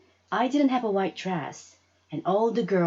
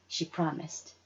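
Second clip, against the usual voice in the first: plain reading voice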